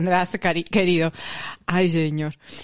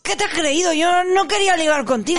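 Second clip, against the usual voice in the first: higher, indignant huffy street harasser; mock offended catcaller; 8 semitones higher than usual. Imitating the catcaller rebuffed on the street, huffy. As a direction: play the rejected man, all wounded pride. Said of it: "entonces es como".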